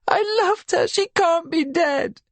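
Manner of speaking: high, slow, sobbing disbelief. A narrator's reading. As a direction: sob it through tears. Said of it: "she sobs".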